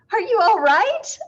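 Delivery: high, loud worried cry; alarmed cry after the fall. A narrator's reading. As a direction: cry out as they scramble up. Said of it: "Missus Prim cried out".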